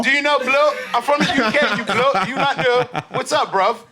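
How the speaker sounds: high-pitched hammed-up British slang; mock British accent. Teasing the pilot with high-pitched mock British. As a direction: ham up a fake London accent, laughing. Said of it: "We be doing British accent too".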